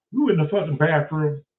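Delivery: ordinary talk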